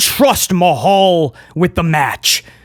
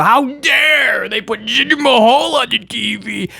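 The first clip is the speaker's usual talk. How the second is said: mock outraged cry, slow and higher; fake outrage; 5 semitones higher than usual. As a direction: wail it in hammed-up indignation. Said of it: "The fake outrage for Jinder Mahal".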